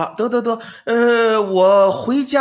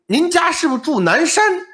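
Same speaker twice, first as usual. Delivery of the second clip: sly probing question; 10 semitones higher than usual. Acted. Scholar springs the question.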